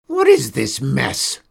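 loud, slow, gruff bellow; bellowing witch. A narrator's reading. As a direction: roar it at the girl like an angry old witch. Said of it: "she bellowed at Mady".

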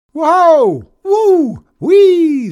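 very high, very loud whoops; shouted. A narrator's reading. Boy spinning on a bucking chair, shouted. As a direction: shriek with the wild ride. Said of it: "Zack shouted".

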